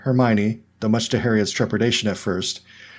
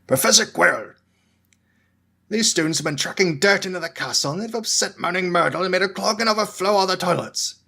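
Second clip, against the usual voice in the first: grumpy caretaker; 9 semitones higher than usual. A narrator's reading. Filch complains to Quirrell.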